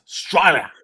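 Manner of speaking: "Australia" squashed into "Strylia", Aussie style; broad Australian accent. Shown as a demonstration. Telling co-host to get it right, broad Australian accent. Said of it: "You gotta get it right".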